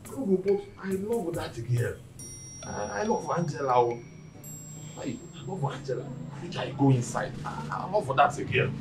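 soft, halting confession of love; lovesick murmur. Acted. Man confessing he loves Angela, lovesick and soft.

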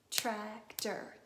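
"Tractor" loud, in two separate beats; counted-out syllables. Shown as a demonstration. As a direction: count out TRAC-TOR in "Tractor". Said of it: "Let's count it".

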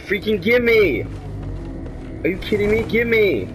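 whiny high yelling; frantic demand. Real, not acted. Grabbing item, frustrated.

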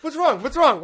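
high, loud worried cry; Katie. Retold or imitated. Partner upstairs fearing for the dogs, alarmed. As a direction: call out in alarm, imitating her. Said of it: "And Katie's like".

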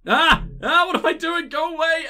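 panicked yelling. Real, not acted. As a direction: flail and yell in a panic. Panicking in photo mode.

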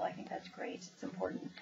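soft unvoiced aside; whispered aside. Real, not acted. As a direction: drop to a whisper for the side remark. Whispered aside during book review.

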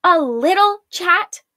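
slow, high, loud disbelieving echo; incredulous. A narrator's reading. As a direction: throw the words back in disbelief. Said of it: "Arella asked incredulously".

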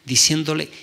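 usual speaking voice

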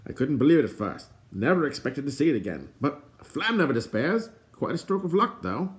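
lightly voiced bard, lilting upward; relieved boast with swooping high pitch; excitable bard. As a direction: play a mildly theatrical bard.

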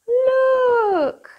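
gasp, then a high excited look; surprised gasp for the children; gasping surprise. Acted. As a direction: act amazed to spot the monkey. Gasps at baby monkey.

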